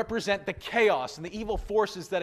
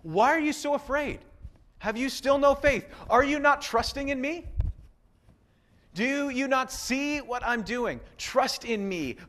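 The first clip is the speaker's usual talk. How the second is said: a gentle, lightly voiced Jesus, higher; Jesus speaking; 6 semitones higher than usual. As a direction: speak as Jesus calming his disciples. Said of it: "This is why Jesus says".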